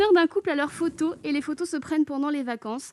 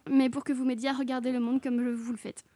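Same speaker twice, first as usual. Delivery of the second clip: ordinary talk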